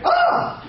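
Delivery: high, sudden mock scream; imitated shriek of shock; startled yelp. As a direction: yelp in exaggerated fright. Officer startled by passport snatch.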